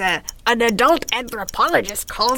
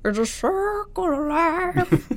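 slow, high crooning imitation of [name]; bad [name] impression; 7 semitones higher, much slower than usual. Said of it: "That's a bad [name]".

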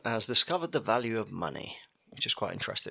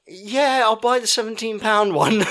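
put-on much higher, louder sulky kid voice; imitating resigned son; 10 semitones higher, 6 dB louder, slower than usual. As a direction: play the son giving in. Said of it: "it was just sort of like".

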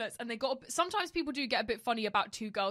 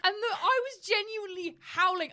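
laughing; 9 semitones higher, 4 dB louder than usual. Real, not acted. Laughing while telling.

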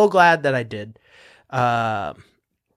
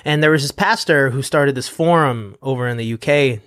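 ordinary talk

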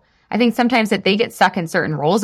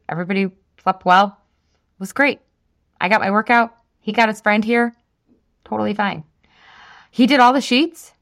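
normal talking voice

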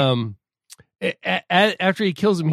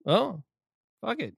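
offhand mutter; 7 semitones higher, 6 dB quieter than usual. Retold or imitated. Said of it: "he's on the radio and he's like".

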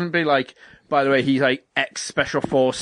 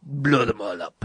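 put-on low, soft-spoken Russian-accented villain; mock Russian accent; 6 semitones lower, 4 dB quieter than usual. Retelling the actor's trailer line, low mock Russian accent. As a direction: imitate a film baddie with a Russian accent, pitched down. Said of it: "like, a Russian accent".

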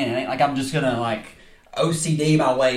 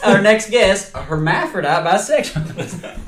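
show host announcer; 5 semitones higher, 4 dB louder than usual. Pretend. Mock show host intro.

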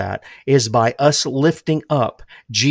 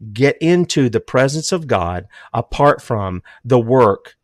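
usual speaking voice

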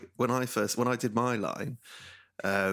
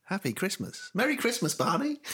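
mock slip; 10 semitones higher than usual. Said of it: "mocking the wrong greeting".

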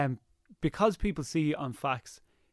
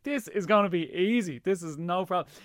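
higher-pitched, mock-cocky quote; breezy confidence; 4 semitones higher, 3 dB louder than usual. Recalling his viral success to the host, breezily overconfident. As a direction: quote yourself being briefly overconfident.

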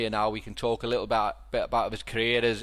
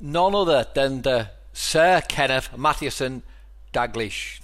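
slow, louder announcer build-up; grand announcement; 2 semitones higher, 4 dB louder, slower than usual. Real, not acted. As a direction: introduce him like a ring announcer. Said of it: "give him his full title here".

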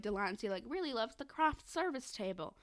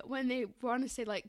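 normal talking voice

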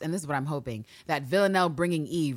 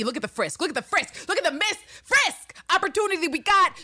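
a wheedling plea that climbs higher; mock whiny plea; 11 semitones higher than usual. Mocking Villanelle's flirty plea.

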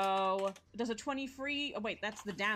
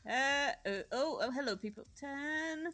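ordinary delivery

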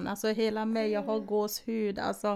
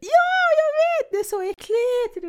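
very high, loud, squealing agreement; emphatic agreement; 12 semitones higher, 7 dB louder than usual. Real, not acted. Friend bursts out agreeing spiders are vile, squealing. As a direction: burst out in shared disgust.

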